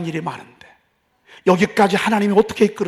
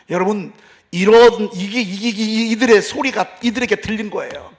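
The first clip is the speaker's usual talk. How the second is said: ordinary talk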